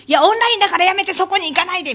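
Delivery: loud, fast, high urgent plea; frantic stage manager. Retold or imitated. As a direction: stop the performer before she hides. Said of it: "舞台監督の私". (焦った舞台監督の叫び)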